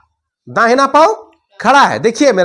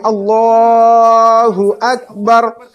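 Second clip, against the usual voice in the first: imam's drawn-out call; imam's takbir; much slower than usual. Shown as a demonstration. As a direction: call it out like the imam leading prayer. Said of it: "इमाम साहब जैसे अल्लाहु अकबर कहते हुए".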